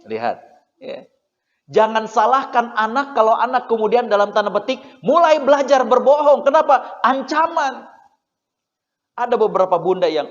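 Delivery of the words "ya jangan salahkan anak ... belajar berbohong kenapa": ordinary talk, nothing marked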